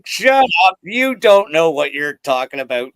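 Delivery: mock a drunk buddy cutting someone off loudly; loud retold rebuff. Retelling a drunk buddy's outburst at the bar, loud.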